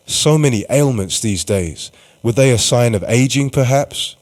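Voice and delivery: ordinary talk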